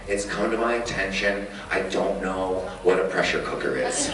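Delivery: low, understated confession; dry mock-serious announcement; deadpan admission. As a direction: deliver it low and matter-of-fact, imitating a clueless friend. Quoting friend's clueless admission.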